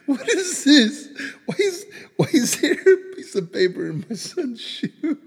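high, loud, laughing mock of wife; laughing quote of wife. Dad quoting wife finding the note, high and laughing. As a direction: squeal wife's puzzled question through laughter. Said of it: "And she's like".

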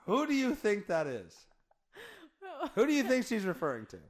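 laughing while asking; words through laughter. Real, not acted. Laughing at the clip.

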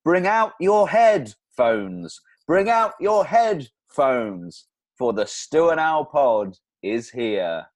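loud, slow proclamation; town crier. Pretend. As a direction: announce it like a town crier in the square. Podcast opening done as proclamation.

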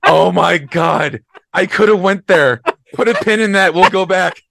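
loud, laughing through the words; cracking up mid-sentence. Real, not acted. Cracking up at the joke.

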